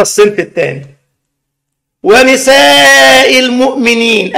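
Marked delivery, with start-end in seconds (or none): 2.0-4.4 slow, drawn-out reciting of the verse; Quranic recitation. Retold or imitated. Preacher reciting the verse to the girl, slow chant.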